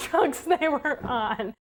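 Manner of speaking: amused, laughing through the words; slow, drawn-out words broken by a laugh. Real, not acted. Doubting she'd share a bed with someone secretive, laughing. Laughs at own remark about drugs.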